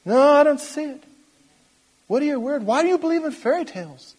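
high-pitched mocking doubter; scoffing unbelievers. Blind world dismisses believers' fairy tales, high scoffing voice. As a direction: put on a high, incredulous voice. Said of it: "And they're going".

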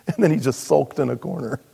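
ordinary delivery

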